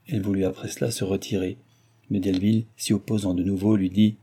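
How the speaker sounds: usual speaking voice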